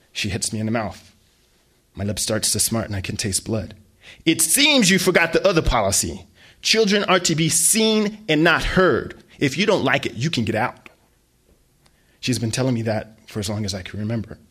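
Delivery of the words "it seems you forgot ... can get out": mother laying down the law to her son; stern mom. Acted. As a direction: pronounce the rule like a verdict. Mom reciting rule.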